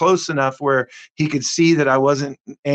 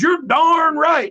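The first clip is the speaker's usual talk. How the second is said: angry yell; 13 semitones higher, slower than usual. Retold or imitated. Stranger yelling at the driver who was on his phone, angry shout. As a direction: throw it back at him like an angry stranger.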